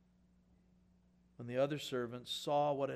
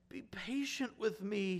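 slow pleading plea; slow and pleading, asking for time; begging; 5 semitones higher, 3 dB quieter, slower than usual. A narrator's reading. Servant begging his master for patience, mild pleading. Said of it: "he begged".

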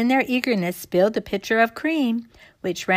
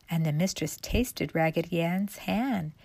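plain reading voice

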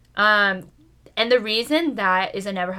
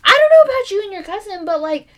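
lecturing voice; 8 semitones higher, 6 dB louder than usual. Retold or imitated. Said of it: "I was like".

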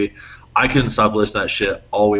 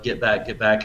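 ordinary talk, nothing marked

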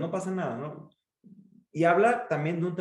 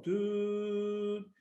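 long monotone high "tuuu" flatline; imitated flatline beep; 6 semitones higher, 5 dB quieter than usual. Shown as a demonstration. Coach imitating a dead patient's electrocardiogram, sustained beep. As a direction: hold one unchanging heart-monitor tone. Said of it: "es como si estuvieras muerto".

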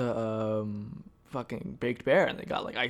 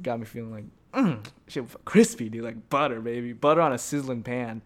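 ordinary talk

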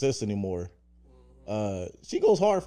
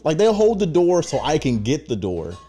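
ordinary talk

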